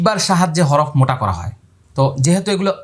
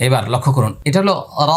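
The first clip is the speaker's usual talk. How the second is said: ordinary talk, nothing marked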